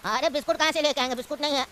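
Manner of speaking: put-on low, easygoing old uncle. Uncle has no biscuits.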